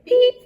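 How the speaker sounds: short high, loud horn beep. A narrator's reading. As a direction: give one sharp, high toot of a horn. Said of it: "Horn went beep".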